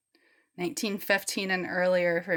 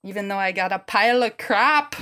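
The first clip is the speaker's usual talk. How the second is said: put-on lightly done Irish accent; a hint of an Irish lilt, louder at the end; 5 dB louder than usual. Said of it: "That's the best Irish accent I can do".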